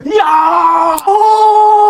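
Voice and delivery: high yelp of pain; a hammed-up hurt yelp; pained cry. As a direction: cry out as if just hit. Kid hit in the fight.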